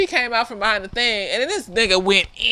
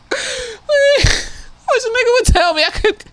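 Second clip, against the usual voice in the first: indignant; 8 semitones higher, 3 dB louder than usual. Acted. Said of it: "indignant rant".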